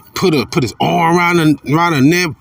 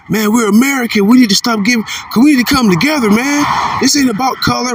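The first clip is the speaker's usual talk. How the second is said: mock Edomite plea; 10 semitones higher than usual. Preacher mocking Edomites' unity talk to listeners, high mock plea. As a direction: parody their pitch for togetherness, voice climbing. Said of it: "When you hear Edomites talking to you".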